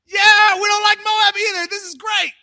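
very high, loud cheering; wildly excited crowd. Retold or imitated. Townsfolk thrilled to hear Moab denounced, shouting. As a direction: yell with gleeful mob enthusiasm. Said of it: "getting more and more excited".

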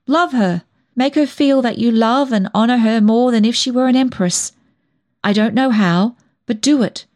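impassioned urging. A narrator's reading. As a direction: urge her on fervently, pitch lifted. Cousin urges girl to love mother.

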